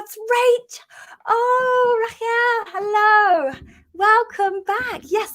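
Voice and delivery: light up and squeal hello; delighted greeting. Real, not acted. Host spotting a returning viewer, squealing with delight.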